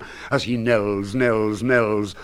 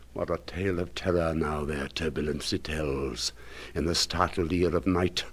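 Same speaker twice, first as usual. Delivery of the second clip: hushed dread; 5 semitones lower, 9 dB quieter than usual. Acted. Dread tale of terror.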